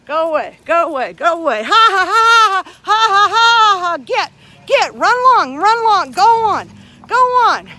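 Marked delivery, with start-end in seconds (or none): 1.6-4.0 high, loud taunting "ha ha ha" at him; mocking laugh. Real, not acted. Woman jeering at the departing guard with a mocking laugh. 4.0-7.7 higher, louder "run along, go on"; shooing him off. Real, not acted. Woman shooing the security guard off, loud and high.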